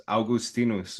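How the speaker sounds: "Augustinus" said ow-goos-TEE-noos; Latin pronunciation. Shown as a demonstration. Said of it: "that's how we would say it".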